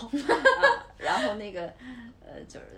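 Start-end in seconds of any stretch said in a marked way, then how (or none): 0.1-0.9 burst of laughter. Real, not acted. Laughing at her own story.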